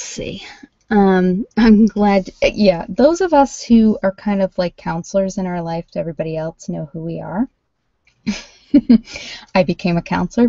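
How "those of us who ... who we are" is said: usual speaking voice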